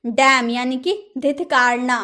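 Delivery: ordinary delivery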